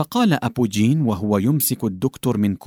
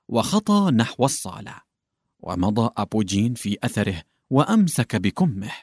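usual speaking voice

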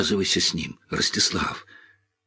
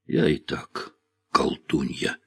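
serious; 5 semitones lower, 6 dB quieter than usual. A narrator's reading. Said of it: "серьёзно произнесла Александра".

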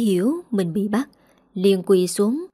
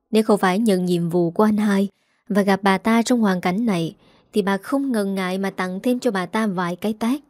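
usual speaking voice

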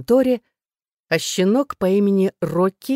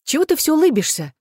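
much higher, impatient outburst; exasperated; 10 semitones higher, 4 dB louder than usual. A narrator's reading. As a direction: snap at a teasing friend. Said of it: "не выдержала я". (не выдержав, раздражённо)

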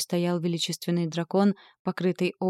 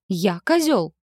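roar; 5 dB louder, slower than usual. A narrator's reading. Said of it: "взревел".

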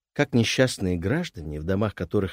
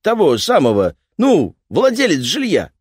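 gruff repairman; 9 semitones higher, 8 dB louder than usual. Acted.

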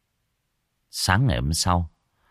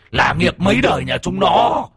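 higher, louder enraged cry; furious shout; 10 semitones higher, 4 dB louder than usual. Acted. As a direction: explode with hatred. Ghost bursts out in hatred.

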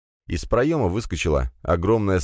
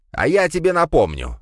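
high, threatening outburst; angry cry; 10 semitones higher, 4 dB louder than usual. A narrator's reading. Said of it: "вскрикнул Пегасов".

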